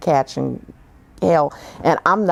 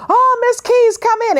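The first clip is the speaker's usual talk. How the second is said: much higher and louder, sugary greeting; gushing welcome; 5 dB louder than usual. Retold or imitated. Agent calling her in before he sees her, high and gushing. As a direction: sing out a big fake-friendly hello. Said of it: "agent greets before seeing her".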